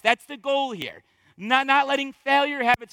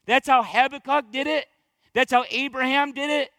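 ordinary delivery

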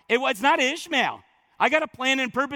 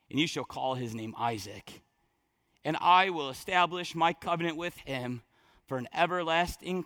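low, soft, reverent scripture delivery; quiet, weighty reverence; hushed solemn preaching; 9 semitones lower, 7 dB quieter than usual. Acted. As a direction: drop right down and let the promise settle. God's solemn promise, read softly.

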